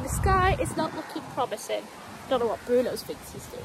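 ordinary delivery

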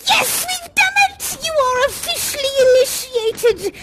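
high shouted cheer, almost tearful; triumphant cheer. Acted. Union leader celebrating finished initiation with sweepers, tearful high shout. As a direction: cry out in triumph, voice cracking with emotion.

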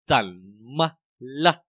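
怎么了 stretched out, syllable by syllable; slow-motion teaching demo of 怎么了; very slow reading. Shown as a demonstration. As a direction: say 怎么了 extremely slowly, with gaps between syllables. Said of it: "mình đọc rất là chậm".

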